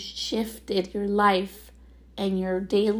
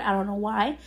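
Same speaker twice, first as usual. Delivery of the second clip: usual speaking voice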